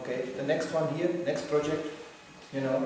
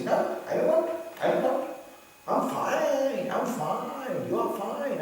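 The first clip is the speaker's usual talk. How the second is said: high, pleading protest of a reluctant devotee; mock whiny refusal; 9 semitones higher, slower than usual. A devotee refusing Krishna's kick, high whiny protest. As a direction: whine it out like someone refusing help.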